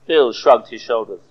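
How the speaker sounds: ordinary talk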